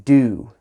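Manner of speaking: "Do." held long and full. Shown as a demonstration. Teacher showing the longest jazz sound, held out. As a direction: sustain "Do." like a long tone. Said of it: "Do is the longest".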